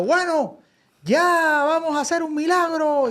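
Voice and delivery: high preacher announcement; mock preacher. Preacher announcing a miracle to the crowd, high voice.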